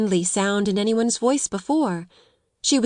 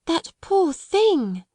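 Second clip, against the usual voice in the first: slow, high, tender pity; gentle, slow "that poor thing"; soft pitying cry; 9 semitones higher, much slower than usual. A narrator's reading. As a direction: sigh it out for the sleeping girl. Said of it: "cried Sarah softly".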